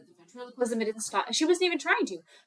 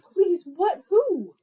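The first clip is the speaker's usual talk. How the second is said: high, louder mock-frantic questions; desperate pleading; 7 semitones higher, 6 dB louder, slower than usual. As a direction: beg urgently to be told, play-acted. Frightened Chris begs for the answer.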